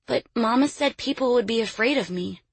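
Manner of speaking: put-on high-pitched little girl; child's voice. Little girl answers her captor.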